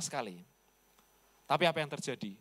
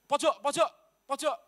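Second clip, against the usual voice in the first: higher, louder shouted "pojok, pojok, pojok"; retold shout; 9 semitones higher, 5 dB louder than usual. Villager on a motorbike yelling at the driver, shouting. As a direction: yell it like an angry motorbike rider. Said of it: "Dia bilang".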